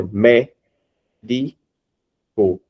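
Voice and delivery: "mé, di, co" in three separate beats; syllable by syllable. Shown as a demonstration. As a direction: pause after each syllable of "mé, di, co". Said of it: "Você pode até fazer". ("mé, di, co" com pausa entre as sílabas)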